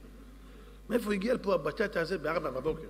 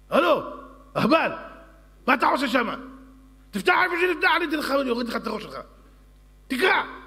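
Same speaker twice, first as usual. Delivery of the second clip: much higher, much louder royal yelling; furious king; 9 semitones higher, 9 dB louder, faster than usual. Acted. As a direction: scream at the fumbling servant. King yells at servant. (צעקת מלך זועם)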